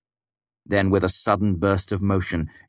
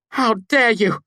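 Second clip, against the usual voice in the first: much higher, louder furious shout; 6 dB louder, slower than usual. A narrator's reading. Devi, bound by magic, shouting in fury. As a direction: hurl it at him in outrage. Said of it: "she shouted".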